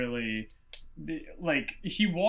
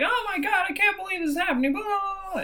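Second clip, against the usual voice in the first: high, fast, panicky mock parent voice; 13 semitones higher than usual. Mocking Sam's parents, high, fast and panicky. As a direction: squeal it like frantic parents. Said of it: "his parents like".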